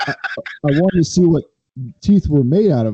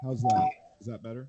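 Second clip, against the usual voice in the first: muffled mic; 12 dB quieter, faster than usual. Real, not acted. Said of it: "lower now".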